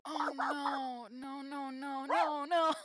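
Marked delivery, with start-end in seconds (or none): none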